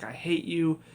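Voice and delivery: normal talking voice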